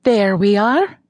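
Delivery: a bright Irish lilt, singing the welcome; lilting Irish accent. A narrator's reading. Irish coach greets the arriving woman in a lilting Irish accent. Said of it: "Moira sang in her lilting Irish accent".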